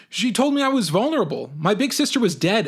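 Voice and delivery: worked-up, indignant storytelling. A narrator's reading. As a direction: protest it as painfully obvious. Narrator indignant at teacher's remark.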